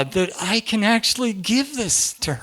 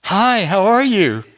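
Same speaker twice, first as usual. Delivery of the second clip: beaming greeting; 4 semitones higher, 7 dB louder, slower than usual. Retold or imitated. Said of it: "I said".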